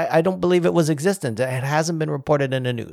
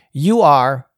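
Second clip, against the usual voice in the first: louder quoted email, hammering each word; all-caps emphasis; 6 dB louder than usual. Retold or imitated. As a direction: read it out like capital letters. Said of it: "in all caps".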